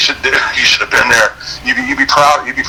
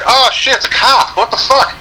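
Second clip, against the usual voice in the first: high, slow startled cry; mock alarm at the flashing lights; 12 semitones higher than usual. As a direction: act out the shock of seeing the cop lights. Retold alarm at cop lights.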